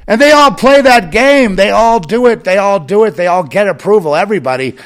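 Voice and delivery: loud, high, ranting outburst; ranting shout. Real, not acted. Ranting to a targeted listener, loud and high-pitched. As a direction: shout it out in full rant.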